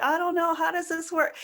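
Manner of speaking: a hint of a puzzled team member asking; mild mock confusion; confused team member. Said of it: "And then they're like".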